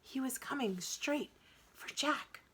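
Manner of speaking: ordinary delivery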